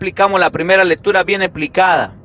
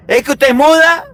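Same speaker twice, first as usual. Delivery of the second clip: exasperated husband; 7 semitones higher than usual. Retold or imitated. Husband confronting his silent angry wife, exasperated. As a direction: demand she answer. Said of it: "el hombre esperando que hable".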